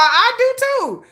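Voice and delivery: high drawn-out delight; excited. Real, not acted. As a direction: squeal in agreement. Excited reaction.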